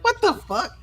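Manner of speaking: very high-pitched mock disbelief; baffled reaction. Bystander hearing about a battery charger, high bafflement.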